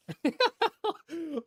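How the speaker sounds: sudden high-pitched burst of laughter. Real, not acted. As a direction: squeal of a laugh. Laughs at a mishap.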